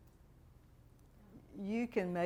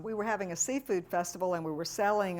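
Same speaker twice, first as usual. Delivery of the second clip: ordinary delivery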